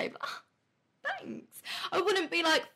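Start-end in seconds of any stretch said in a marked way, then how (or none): 0.2-1.6 high, soft, pleased little thanks; sweet thank you. Retold or imitated. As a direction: chirp it out, flattered. Acting a polite reply to compliment.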